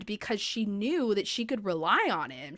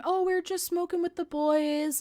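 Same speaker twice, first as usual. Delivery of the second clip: higher-pitched mock innocent husband; imitating a husband's offhand excuse; mock casual excuse; 8 semitones higher than usual. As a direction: brush it off in a put-on higher voice. Acted excuse of late-coming husband.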